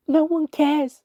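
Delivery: high, loud cry of despair; anguished shout. A narrator's reading. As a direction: hurl the words out. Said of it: "and I shouted".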